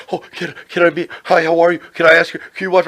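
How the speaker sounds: mock the overeager young wrestler; eager indie fan. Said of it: "they're like".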